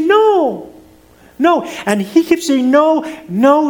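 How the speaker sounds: put-on high, pleading child's no; imitated child denying. Son denying to his father, a high pleading child's voice. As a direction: plead no like a little boy about to cry.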